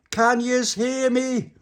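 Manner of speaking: high, loud, drawn-out call; shouting. Acted. Little Pappy calling to the others, shouting. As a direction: call it through to the next room. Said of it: "Little Pappy shouted".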